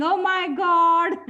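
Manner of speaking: loud, high, drawn-out mock wail. Homeowner learns the house is still his, mock wailing. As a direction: wail it out slowly like a man crying. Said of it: "then again he starts crying".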